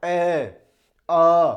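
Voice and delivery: long, loud open vowels ए and आ sounded out; vowel demo. Shown as a demonstration. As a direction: hold each vowel ए, आ like a teacher. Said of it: "जब आप ए और आ का उच्चारण करते हो".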